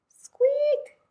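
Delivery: very high squeak; mouse squeak. Acted. The hidden mouse squeaking at Owl, high and tiny.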